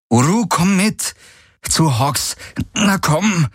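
high-pitched, insistent command; urgent coaxing. A narrator's reading. Kirai tugs at Uru's bridle, urging insistently. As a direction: urge the animal along.

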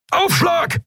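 very high, urgent yell; bellowed warning. A narrator's reading. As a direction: yell to brace for impact. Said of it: "brüllte Keru".

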